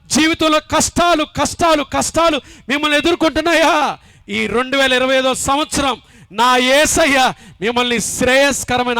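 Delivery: fiery sermon appeal building to hallelujah; preaching at full force. Acted. Building altar call to congregation.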